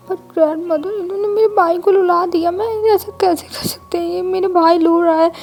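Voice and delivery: put-on high, loud, tearful little girl wail; crying girl. A narrator's reading. Little girl wailing to her grandmother, loud and high. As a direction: wail like a child about to cry. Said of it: "वो भी रोने लगती है".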